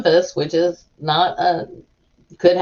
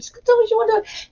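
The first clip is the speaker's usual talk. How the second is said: much higher, fast squeaky panic; panicked inner voice; much faster than usual. Retold or imitated. Dreading the pharmacist will refuse, squeaky mock panic. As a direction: spiral into panic, voice jumping up. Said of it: "And I'm like".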